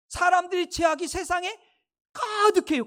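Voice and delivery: preaching emphasis. Acted. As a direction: drive it home to the congregation. Preacher repeats verse emphatically.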